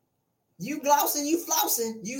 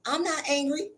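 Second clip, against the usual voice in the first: fake innocent calm; 6 dB quieter than usual. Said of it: "you be like".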